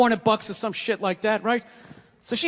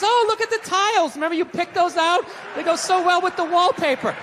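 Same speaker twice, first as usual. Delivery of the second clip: high, sugary put-on cooing; mock sweet talk; 6 semitones higher than usual. Husband soothing wife while pinning her down, mock sweet. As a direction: coo sweet nothings in a raised pitch. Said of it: "you whisper sweet things".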